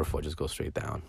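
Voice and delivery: normal talking voice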